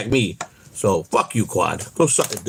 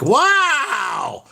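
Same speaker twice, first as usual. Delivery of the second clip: higher, shouted wow; mock shock; 12 semitones higher than usual. Teasing himself for outing Ray, mock shocked shout. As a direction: yell it in mock amazement.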